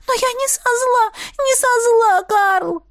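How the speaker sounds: high, pleading wail; remorseful. A narrator's reading. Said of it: "смотрела на него с раскаянием".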